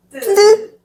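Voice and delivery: 트 said tense and high; tense Korean tt. Shown as a demonstration. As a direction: pinch 트 tight and raise it. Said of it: "Add more tension with a higher pitch".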